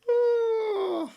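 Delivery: high, loud wailing oh; anguished cry. Real, not acted. Streamer crying out at a near miss. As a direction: cry out in agony.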